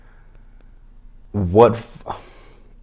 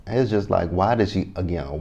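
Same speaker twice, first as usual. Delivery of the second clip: ordinary talk, nothing marked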